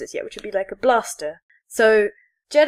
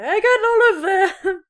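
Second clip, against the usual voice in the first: higher, slow, laughing shout; laughing triumph; 10 semitones higher, 3 dB louder, slower than usual. Retold or imitated. Retelling the finished quest, laughing triumphant shout. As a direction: crow it with a laugh.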